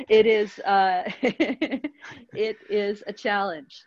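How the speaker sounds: chuckling through a hesitant answer; laugh, then draw the answer out slowly; slow reply broken by chuckles; amused, laughing. Real, not acted. Laughs at a knowing question.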